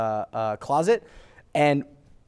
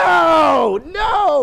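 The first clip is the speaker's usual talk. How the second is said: high, loud retold protest; emphatic refusal; 11 semitones higher, 6 dB louder than usual. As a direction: cry no in mock horror. Retold refusal to name the mouse.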